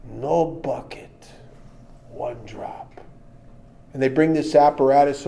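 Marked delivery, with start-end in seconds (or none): 0.1-3.0 quiet, slow, unbothered doctor; calm doctor. Retold or imitated. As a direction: answer softly and deliberately, totally calm. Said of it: "doctor replies".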